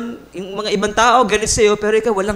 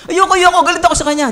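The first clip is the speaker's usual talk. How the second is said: stubborn refusal; 8 semitones higher, 3 dB louder, much faster than usual. Acted. Stubborn person refusing again.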